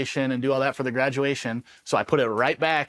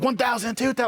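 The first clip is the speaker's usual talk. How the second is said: high, yelled count while jumping; screaming the count; 10 semitones higher than usual. Retold or imitated. Mid-jump paratrooper yelling his count to himself, high mock scream. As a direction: yell the count out as you fall. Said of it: "like, screaming to myself".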